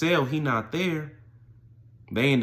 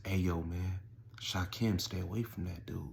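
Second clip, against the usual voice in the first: hushed imitation of a warning; calm, almost fearful; 3 semitones lower, 10 dB quieter than usual. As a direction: act out a soft, wary warning. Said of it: "say calmly, and with almost fear in their voice".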